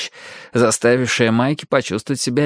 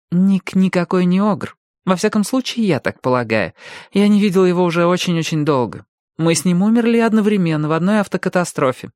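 put-on high-pitched girl, defending her friend; earnest girl character; 7 semitones higher than usual. Alli explains who her friend Nick is, earnest. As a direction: set them straight in a higher girl's voice.

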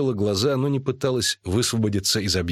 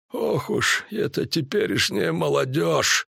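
slow, higher-pitched grumbling druid; snorting disapproval; 4 semitones higher, slower than usual. A narrator's reading. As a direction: snort it slowly at the young. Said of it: "фыркнул Мышавур". (ворчливое фырканье старшего на молодёжь)

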